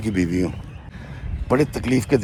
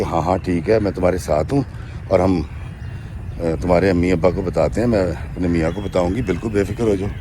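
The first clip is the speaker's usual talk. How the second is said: soft, lower, tender comfort; loving reassurance; 5 semitones lower, 4 dB quieter than usual. Retold or imitated. Sister-in-law comforting the pregnant widow, softly tender. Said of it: "بڑی محبت سے، بڑے پیار سے".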